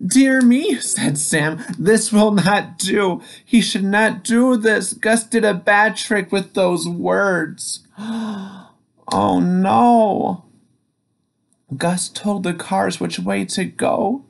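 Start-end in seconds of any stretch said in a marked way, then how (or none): 0.1-7.8 dismayed scolding. Acted. Sam dismayed at the crash. 8.0-10.4 sharp gasp then high oh no; shocked gasp. Acted. Narrator gasps at crash.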